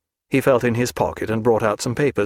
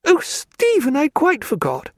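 much higher, sudden exclamation; cry out. A narrator's reading. Diana suddenly remembering, high excited cry. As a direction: burst out as it springs to mind. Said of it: "Then she cried".

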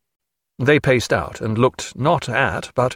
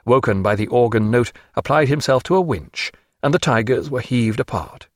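ordinary delivery, nothing marked

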